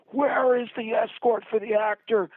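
high, loud yell of outrage; screaming. Retold or imitated. Director running into production office, screaming at everybody. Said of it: "screaming at everybody".

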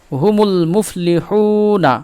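"হুমুল মুফলিহুনা" slowly, with a long held "হু" before "না"; model recitation. Shown as a demonstration. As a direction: recite it measured, full l in "হুমুল". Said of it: "আমরা এইভাবে পড়ব".

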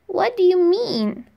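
put-on boy asking the firefly to explain; curious little boy. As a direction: ask puzzled. Boy asks.

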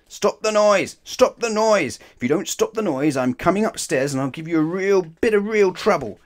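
loud, high angry bellow; furious parental telling-off; dad shouting. A narrator's reading. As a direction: shout upstairs like a fed-up dad. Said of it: "he shouted up to them".

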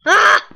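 sudden high scream; startled scream. Real, not acted. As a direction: shriek in surprise. Startled by the dog.

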